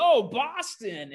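high, drawn-out delight; delighted reaction. Retold or imitated. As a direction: imitate someone thrilled, stretching the words. Retelling preacher's delighted reaction.

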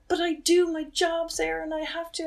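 high, loud retold objection; distressed protest. Sister arguing back at her brother about her job, distressed protest. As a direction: object urgently, pitch right up.

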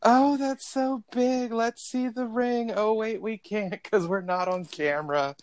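high, slow, drawn-out cooing; mock gushing. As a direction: gush over the ring, hammed-up. Pretends to gush over the ring.

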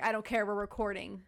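firm, loud declaration; loud insistence. Retold or imitated. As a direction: insist on it loudly. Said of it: "I was like".

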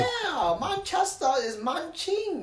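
high, slow mock British voice; soft high-pitched British parody; mock British accent. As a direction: draw it out in fake British, softly.

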